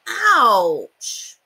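a hurt wishing well; well says ouch. A narrator's reading. Well hit by a penny, pained yelp. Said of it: "said the well".

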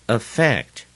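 affect with light A, strong FECT; second-syllable stress. Shown as a demonstration. Teacher drilling new words, affect stressed on FECT. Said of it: "重音在第二个音节".

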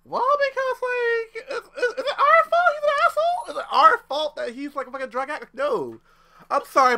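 usual speaking voice

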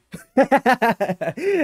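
high breathy burst of laughter. Real, not acted. Watching her loot the body, bursting laugh. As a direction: crack up at the screen.

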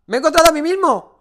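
sudden high surprised yell; startled shout. Real, not acted. Startled at finding himself in game.

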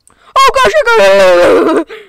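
very high and loud, drawn-out; real gasping shout of joy; excited shout. Real, not acted. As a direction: yell in sudden triumph. Finds exit, shouts.

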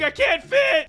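high, frustrated yell; high-pitched wail of alarm; panicked shout. Real, not acted. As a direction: cry out that you're stuck. Stuck in game.

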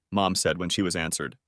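ordinary delivery, nothing marked

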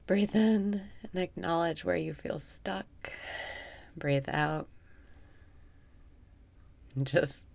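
guided meditation. Acted. Guiding listeners through breathwork in a soft meditation voice. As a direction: guide the breath calmly, half amused.

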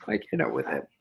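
breathless whisper. Real, not acted. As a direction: whisper it, overwhelmed. Overwhelmed by the dog.

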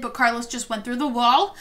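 ordinary delivery

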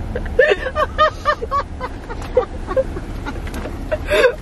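high wheezing laugh near tears; helpless laughing fit; hysterical laugh. Real, not acted. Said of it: "Why are you laughing so hard?".

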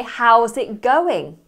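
"How's it going?" said loud and slow, going falling; stress on going. Shown as a demonstration. Teacher asking how the project is going, loud and slow. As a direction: ask warmly, leaning on going. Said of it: "And you could say".

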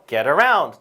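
teacher's demo with a swelling, falling "around"; stress on "around". Shown as a demonstration. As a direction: put the weight on the back word, "around". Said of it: "뒤에 강세 주셔야 돼요".

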